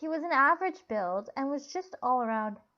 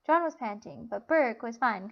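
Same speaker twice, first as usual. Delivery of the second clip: ordinary delivery, nothing marked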